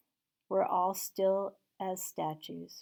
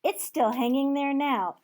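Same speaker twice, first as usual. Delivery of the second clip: put-on higher, loud young boy; boy's voice; 6 semitones higher, 8 dB louder than usual. Boy in Sunday clothes answering Scrooge, high childlike. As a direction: answer as a surprised street boy. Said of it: "returned the boy".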